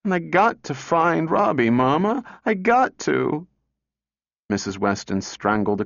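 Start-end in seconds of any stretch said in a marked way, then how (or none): 0.0-3.5 tearful pleading. A narrator's reading. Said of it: "she burst into a sudden storm of tears".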